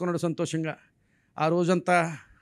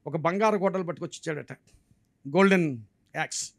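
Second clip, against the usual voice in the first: ordinary talk, nothing marked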